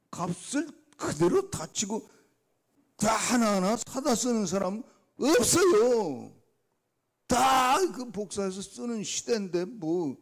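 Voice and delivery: high, loud, indignant protest; arguing with God. Retold or imitated. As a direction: complain to God like a stubborn child. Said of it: "좀 따졌어요". (하나님께 따지듯 높고 큰 목소리로 항의)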